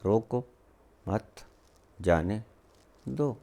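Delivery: रोको, मत, जाने, दो each set apart by a pause; neutral, separated words: रोको... मत... जाने... दो. Shown as a demonstration. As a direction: count out रोको / मत / जाने / दो singly. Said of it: "शब्द वही चार के चार".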